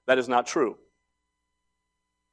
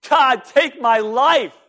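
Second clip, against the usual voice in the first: mock anguished cry, much higher and louder; despairing plea; 13 semitones higher, 9 dB louder, slower than usual. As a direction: beg God to end it, hammed up. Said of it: "Elijah was crying".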